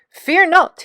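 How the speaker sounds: high, loud heroic shout. A narrator's reading. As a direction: proclaim the rescue boldly. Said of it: "he shouted".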